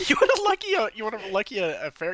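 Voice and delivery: high teasing barker voice; carnival barker. Acted. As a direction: taunt like a barker. Barker NPC answers mockingly.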